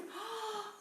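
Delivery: sharp amazed gasp. Acted. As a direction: gasp as if seeing it first. Reveal of the new landscape.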